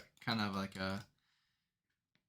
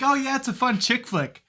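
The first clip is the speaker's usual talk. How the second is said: higher, louder breezy mom voice; imitating mom; 10 semitones higher, 5 dB louder than usual. Quoting mom's text about the sad film, mock breezy. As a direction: play mom brushing it off cheerfully. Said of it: "She's like".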